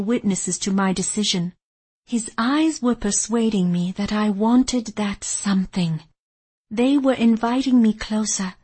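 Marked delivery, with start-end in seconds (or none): none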